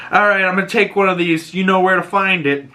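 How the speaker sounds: high, sassy parting line; sly Agatha. Retold or imitated. Agatha pockets the egg, sassy and sly.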